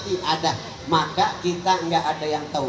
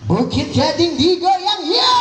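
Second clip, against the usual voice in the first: much higher, slow shouted call; dangdut MC hype; 12 semitones higher, 5 dB louder, slower than usual. Retold or imitated. Dangdut emcee rouses the village crowd, shouting.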